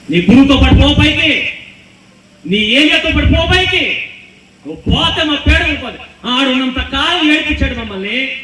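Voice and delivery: loud, high mocking shout; jeering taunt. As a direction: jeer him to go up. Acted mockers telling Elisha go.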